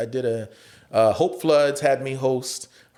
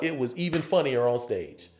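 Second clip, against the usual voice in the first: ordinary talk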